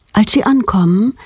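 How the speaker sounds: plain reading voice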